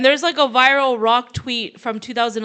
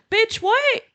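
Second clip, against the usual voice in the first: higher-pitched "what" in disbelief; incredulous retort; 10 semitones higher than usual. Real, not acted. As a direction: toss it back in disbelief.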